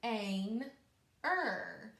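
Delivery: each part apart: ter, ain, er; segmented syllables. Shown as a demonstration. Teacher sounding out parts for pupils, one by one. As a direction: sound out chunk by chunk. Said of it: "let's say those parts".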